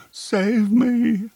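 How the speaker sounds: a woman being led off to skate, calling back to her boyfriend, mock plea; mock pleading. A narrator's reading. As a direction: cry out for rescue, half in jest.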